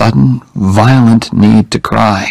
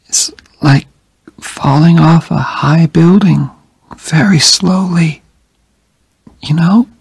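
high, faint, whispered voice; dying young woman; 8 semitones higher than usual. A narrator's reading. Jenny comforting Oliver from her deathbed, weak and whispered. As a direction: speak like someone very weak in bed.

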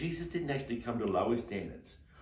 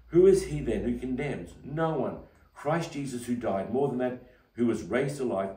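fervent scripture reading; 3 semitones higher, 6 dB louder than usual. Acted. Reading Romans with fervour.

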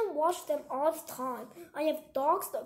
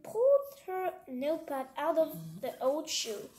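ordinary delivery

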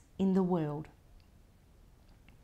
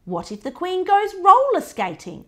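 cheeky question; 11 semitones higher, 10 dB louder than usual. Acted.

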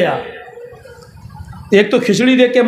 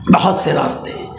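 "बहुत से रास्ते" repeated in a hushed whisper; sly, breathy aside; whispered echo; 3 semitones lower, 3 dB quieter than usual. Shown as a demonstration.

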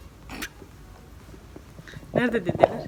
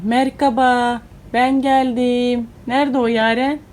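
louder, coaxing a smile out of her; cheery coaxing; 2 semitones higher, 8 dB louder, slower than usual. Real, not acted. Mother cheering up her sulky daughter, loud and playful.

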